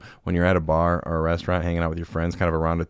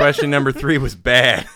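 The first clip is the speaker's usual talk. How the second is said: mock scolding; 7 semitones higher, 7 dB louder than usual. Teasing guest, loud and laughing.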